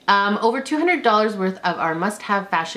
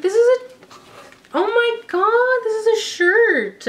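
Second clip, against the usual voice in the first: excited squeal; 13 semitones higher than usual. Real, not acted. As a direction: gasp out the surprise with joy. Surprise mystery item unboxed.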